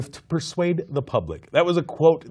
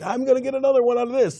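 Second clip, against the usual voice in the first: much higher, quicker mock-greedy voice; Sandra Bullock mocked; 9 semitones higher, faster than usual. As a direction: parody an actress chasing an award. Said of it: "her just being like".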